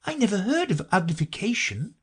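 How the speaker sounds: put-on high, tentative girl; Alice. A narrator's reading. Alice ventures a doubt, high and tentative. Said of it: "Alice ventured to say".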